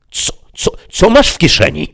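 high, loud demonic demand; a devil suspicious and greedy; Mefisto demanding. Acted. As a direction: seize him and interrogate. Mefisto grabs narrator.

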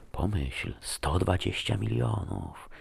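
soft, slow seductive murmur in the ear; whispering. A narrator's reading. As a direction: tempt them quietly, drawing it out. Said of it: "szeptała mi do ucha". (półszeptem, kusząco do ucha)

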